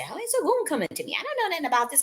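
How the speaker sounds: high, startled disbelief; bewildered question. Retold or imitated. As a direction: ask it as if thrown off guard. Retelling her shocked question.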